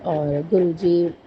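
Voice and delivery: ordinary delivery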